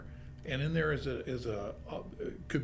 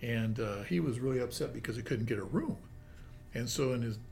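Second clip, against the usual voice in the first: usual speaking voice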